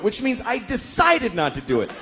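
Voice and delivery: ordinary talk, nothing marked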